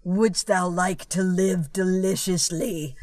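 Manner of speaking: slow, drawn-out tempting voice; slow sinister invitation, hammed up; seductive devil goat. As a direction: tempt them slowly, like the devil. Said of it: "And then he says".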